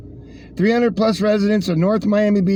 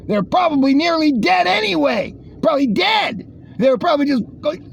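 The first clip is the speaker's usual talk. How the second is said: sarcastic mock outrage; 8 semitones higher than usual. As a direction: pretend to be outraged, dripping sarcasm.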